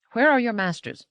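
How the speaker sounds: high, insistent question with an edge of compulsion; quick, compelling demand. A narrator's reading. Woman questions robot about its masters, compelling. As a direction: press for an answer, pitch jumping up. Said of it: "said to the robot quickly".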